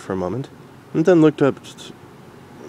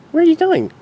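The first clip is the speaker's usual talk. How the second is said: very high filly voice; curious filly; 4 dB louder than usual. Acted. Dinky asking her mom, curious filly. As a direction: ask with childlike curiosity.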